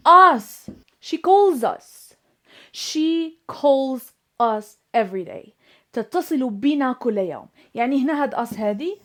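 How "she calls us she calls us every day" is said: very slow, each word set apart; slow model sentence. Shown as a demonstration.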